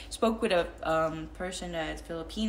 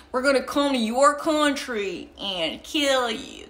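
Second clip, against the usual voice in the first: put-on higher, louder country-accented politician voice; George Bush impression; 6 semitones higher, 6 dB louder than usual. Imitating a president with a country accent, loud comedian's bit. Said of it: "that's what I remember with that accent".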